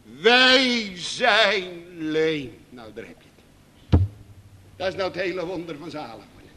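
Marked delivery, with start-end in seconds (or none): 0.0-2.6 "Wij zijn leem" drawn out very slowly, each word weighed; slow solemn confession. Acted. The humbled believer, slow solemn confession. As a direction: let "wij" land heavily, slowly. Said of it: "schreeuwen ze het uit".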